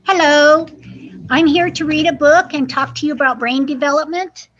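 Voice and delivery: lively, expressive picture-book reading; reading to children. Acted. Librarian reading aloud to young children, lively storytime voice. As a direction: read it like story time for little kids.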